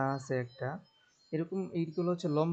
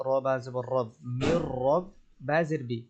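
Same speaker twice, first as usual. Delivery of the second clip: ordinary talk, nothing marked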